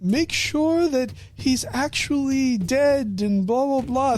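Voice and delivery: drawn-out royal fussing; pompous king. Retold or imitated. False king insisting the champion is dead, slow and haughty. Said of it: "the king was like".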